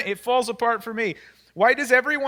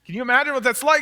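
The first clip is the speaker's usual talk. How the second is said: usual speaking voice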